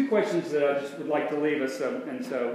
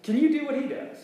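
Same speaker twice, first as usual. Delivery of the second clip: much higher, ringing rhetorical question; echoing Don's question; 12 semitones higher, 3 dB louder than usual. Acted. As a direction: lift it high and leave it hanging. Repeats Don's question to congregation.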